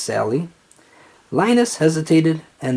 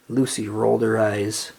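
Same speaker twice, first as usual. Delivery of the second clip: ordinary delivery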